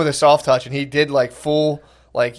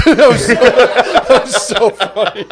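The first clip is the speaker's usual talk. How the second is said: higher, drawn out through laughter; laughing hard; 7 semitones higher, 4 dB louder, slower than usual. Real, not acted. Friend recalling a childish joke at thirty-four, laughing hard. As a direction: crack up while saying it. Cracking up at own memory.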